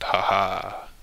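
hooting ha ha from rowdy cubs; jeering laugh. A narrator's reading. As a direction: jeer at the badger who fell. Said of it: "hooted and shouted".

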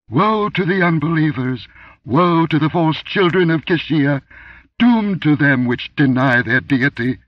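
high, loud booming goddess proclamation; weird unhuman voice. A narrator's reading. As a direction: thunder a curse down from the roof. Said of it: "That weird, unhuman voice rolled down".

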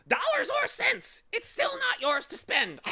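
it's still "not" yours to spend, "not" stressed in a high loud haughty squawk. Acted. Bird correcting the boy, haughty stress on "not". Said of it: "the bird replied haughtily".

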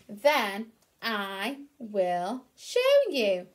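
very slow, patient duck voice; Dizzy Duck. Acted. Dizzy Duck promising to show the ducklings, slow patient. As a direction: show them kindly and slowly. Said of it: "said Dizzy".

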